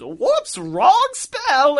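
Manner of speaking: very high, loud cartoon yelp; hammed-up, high-pitched oops; bungling wizard. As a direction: squeal it like a hapless magician. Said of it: "can't ever get his spells right".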